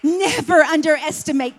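loud, high preaching at full force. Acted. Preacher promising peace and joy in church, at full force. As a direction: shout it to the rafters.

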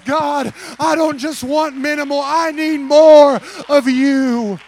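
loud high plea, slowing at the end; loud prayer cry. Shown as a demonstration. Pastor leading his church's prayer, loud and high. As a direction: lead the church in a crying prayer. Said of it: "begin to lift up your voice".

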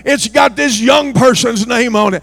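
fiery revival shouting; preaching at full force. Acted. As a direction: thunder the warning at the pews. Preacher building to a climax.

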